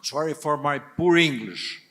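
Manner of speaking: usual speaking voice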